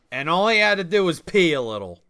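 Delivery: usual speaking voice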